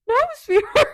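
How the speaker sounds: high, loud protest through laughter; laughing flustered denial. Retold or imitated. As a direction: deny it loudly while cracking up.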